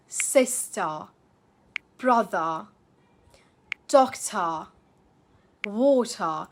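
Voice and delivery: SISTER, BROTHER, DOCTOR, WATER ending in a long "uh", no R; non-rhotic British endings. Shown as a demonstration. Teacher modelling words for learners, non-rhotic endings. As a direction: drop the final R in WATER and DOCTOR. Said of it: "You can even pronounce words like teacher".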